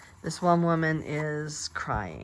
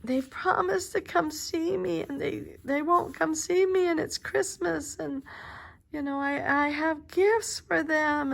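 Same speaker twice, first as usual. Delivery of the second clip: put-on high, pleading voice of a forgotten old woman; lonely old woman; 11 semitones higher than usual. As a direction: plead high like a woman whose family never came. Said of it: "this woman is like".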